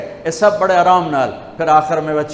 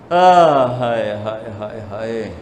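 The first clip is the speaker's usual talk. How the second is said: sorrowful sighing wail; 6 semitones lower than usual. Real, not acted. Preacher grieving over such great souls, sighing lament. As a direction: sigh out a lament, falling away.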